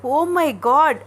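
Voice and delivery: a bright, excited gasp of joy; excited exclamation. A narrator's reading. Sister seeing the newborn baby, excited. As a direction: burst out in delight. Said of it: "ఎగిరి గంతులు వేస్తోంది".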